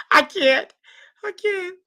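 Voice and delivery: high, breathless through laughter; can't stop laughing; giggling out the words. Real, not acted. Helpless laughter.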